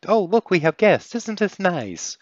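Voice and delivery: high, loud chirpy cat-folk voice, drawn out at the end; squeaky, gullible Cheshire welcome; oblivious Cheshire. Acted. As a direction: greet invaders like delighted hosts. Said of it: "The Cheshire just never realized".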